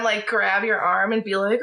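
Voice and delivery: normal talking voice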